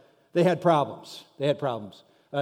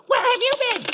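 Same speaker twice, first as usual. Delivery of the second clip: very high, loud screechy wife; hammed-up piercing nag; mock wife voice; 5 dB louder than usual. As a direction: shriek it in falsetto like an outraged wife. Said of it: "This is my best voice".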